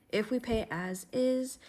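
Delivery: ordinary talk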